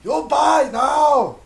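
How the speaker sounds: loud, high, drawn-out shout; caricatured telemarketer yelling slowly; mock pushy phone solicitor. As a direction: bellow a slow, high-pitched sales demand.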